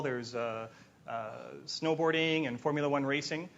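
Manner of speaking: usual speaking voice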